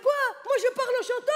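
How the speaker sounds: high, sing-song Tunisian protest; indignant Tunisian man. Retold or imitated. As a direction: protest in a singing Tunisian accent. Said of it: "il m'a dit". (protestation indignée, accent tunisien chantant)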